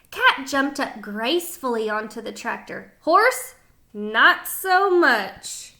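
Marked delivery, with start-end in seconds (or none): none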